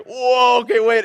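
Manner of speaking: high, loud startled yelp of alarm; the bison is suddenly too close, yelp it. Retold or imitated. Retelling bison face-off to congregation, high loud startled yelp. Bison charging straight at him.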